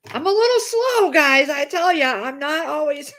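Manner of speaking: high jokey squeal; silly high voice. Pretend. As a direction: tease herself in a goofy high pitch. Jokes about being slow.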